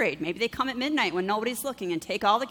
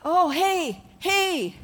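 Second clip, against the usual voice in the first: high, bright hey; stranger calling out; 8 semitones higher, 3 dB louder, much slower than usual. Retold or imitated. Woman walking up on the sidewalk, calling out high. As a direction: hail someone from the sidewalk. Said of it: "they're like".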